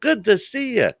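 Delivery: high, drawn-out fake friendly hello; mock fake-friendly greeting. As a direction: ham up an overly chummy greeting. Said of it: "I hate that when they say".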